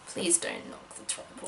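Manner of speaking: whisper. Real, not acted. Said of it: "aside to boyfriend".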